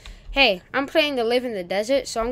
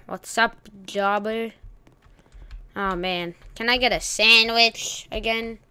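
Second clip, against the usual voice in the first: ordinary talk, nothing marked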